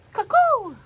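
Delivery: high, loud falling cuckoo; cuckoo call. Retold or imitated. Narrator repeats the cuckoo call, high and loud.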